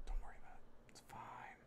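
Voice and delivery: whispered under his breath, soft and calming; self-reassuring whisper. Real, not acted. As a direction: whisper it to yourself so the worry passes. Reassuring himself while painting.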